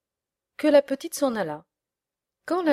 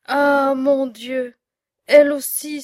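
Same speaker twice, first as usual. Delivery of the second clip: stunned cry; 4 semitones higher, 9 dB louder than usual. A narrator's reading. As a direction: cry out in shock at the news. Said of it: "S'écria monsieur Seguin stupéfait". (cri stupéfait et désolé)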